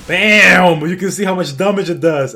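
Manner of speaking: loud, high burst on the hit; excited shout. Real, not acted. Streamer showing off his hammer damage, excited shout. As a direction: explode on the bam, stay loud and high.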